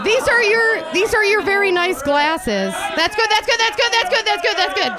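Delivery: high, bright-eyed praising voice; mock praise. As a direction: coo over them like a pet. Praising wine helper in high voice.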